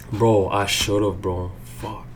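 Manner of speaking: ordinary talk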